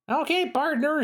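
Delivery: twang it like a cowgirl clerk; Southern clerk. Acted. Clerk character speaks.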